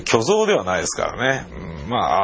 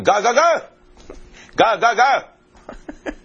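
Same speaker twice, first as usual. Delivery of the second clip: mock barking cop; 12 semitones higher than usual. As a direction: ham up the cop yelling nonsense. Mimicking cop's barking noise. (警官の怒鳴り声を大げさに真似)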